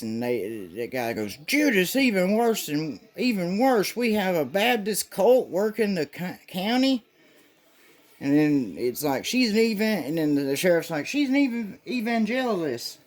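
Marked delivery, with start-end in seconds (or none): 1.5-7.0 high, indignant hammed-up cop; mock outraged cop. Outraged cop over Baptists. 9.2-10.0 high hammed-up sheriff voice; a lightly squeaky sheriff; mock sheriff. Sheriff quoted. 11.1-13.0 high hammed-up sheriff voice; a lightly squeaky sheriff; mock sheriff. Sheriff quoted.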